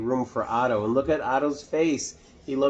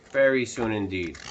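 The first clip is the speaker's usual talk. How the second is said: usual speaking voice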